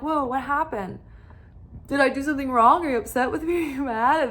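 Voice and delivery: a touch of panicky neediness; mock anxious pleading. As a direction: play the insecure partner, half-joking. Voicing an anxious clingy woman.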